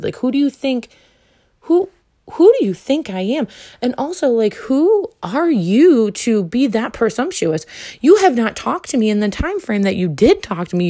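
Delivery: high, heated telling-off; indignant scolding. Retold or imitated. Scolding the presumptuous date, high and heated. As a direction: put him in his place. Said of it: "I was like".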